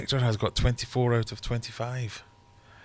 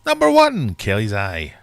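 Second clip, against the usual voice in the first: loud sing-song number call; bingo caller; 3 dB louder than usual. Acted. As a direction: boom it out to the hall.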